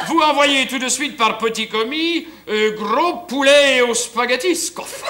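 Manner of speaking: high, stretched comic Russian; Poliakov's fake Russian accent. Fake Poliakov orders chicken from grocer, hammed-up Russian accent. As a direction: order grandly in a badly faked Russian accent. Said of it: "Il prend l'accent russe".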